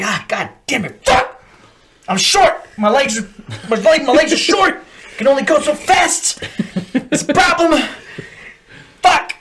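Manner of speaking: high, loud comic whine; hammed-up struggling recruit, half laughing; exasperated recruit. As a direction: protest helplessly in a strained high voice. Acts out struggling short-legged recruit.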